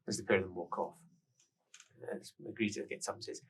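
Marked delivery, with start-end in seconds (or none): none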